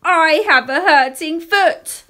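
put-on high, slow, loud child's complaint; wailing yell for attention; shout from behind. A narrator's reading. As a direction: call it out to someone far ahead. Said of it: "so I had to shout".

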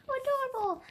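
high, delighted coo. Real, not acted. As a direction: coo over something cute. Delighted by a tomato flower.

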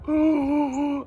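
high, loud, startled ooh; a sudden high ooh of realising; ooh of regret. Acted. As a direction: catch yourself in a high ooh. Voicing a child's regret.

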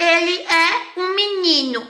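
high, drawn-out sing-song, word by word; mock over-articulation. Shown as a demonstration. Teacher mocking the translation students expect, exaggeratedly slow.

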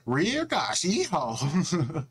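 country yeehaw. Retold or imitated. Narrator hamming up a Southern country yeehaw, laughing. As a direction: whoop it like a hammed-up cowboy. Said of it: "good old country accent".

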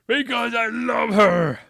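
high, loud, slow dramatic declaration; passionate outburst. Acted. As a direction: belt out the confession. Dramatic love confession.

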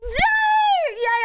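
high, loud yay; excited cheer. Real, not acted. Cheering to chat about playing with Ray, high and loud. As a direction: squeal it with real delight.

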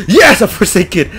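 high, loud, drawn-out cheer; triumphant yell. Real, not acted. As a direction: shout it in sudden triumph. Celebrates a find.

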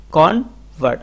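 "Convert" as kon, pause, vart; split in two. Shown as a demonstration. Teacher showing word number two, split in two. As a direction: break "convert" into two syllables. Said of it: "আপনাকে এটা আলাদা করতে হবে".

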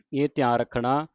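normal talking voice

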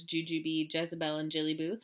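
ordinary delivery, nothing marked

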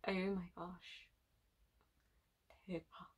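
soft, low whisper, dazed; swooning whisper. Real, not acted. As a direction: barely breathe the word. Swooning over a dancer.

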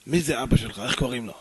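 acted insistent stranger; gruff interrogation. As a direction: press him low and forcefully.

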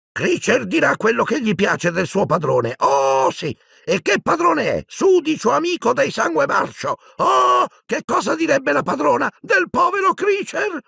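high, put-on elf voice full of scorn; resentful house-elf. Servant elf rants about his unworthy master, scornful and high. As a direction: whine and rant in a high creature voice.

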